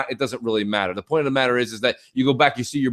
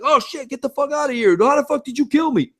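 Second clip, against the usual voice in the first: mock yelling; 11 semitones higher than usual. Friend retelling gamers yelling down the hall, high mock yell. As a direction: imitate gamers yelling in a raised pitch. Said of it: "this yelling's going back and forth".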